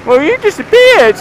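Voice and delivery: high, loud hammed-up yell; mock angry shout. Retelling a former school friend's outburst, hammed-up furious yell. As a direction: shout it back as the indignant old friend. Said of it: "he had the spite to go".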